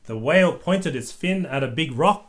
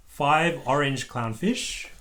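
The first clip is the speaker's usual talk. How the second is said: usual speaking voice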